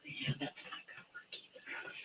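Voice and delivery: soft unvoiced whisper. Real, not acted. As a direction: whisper to yourself while working. Sudden whispering while shelving pencils.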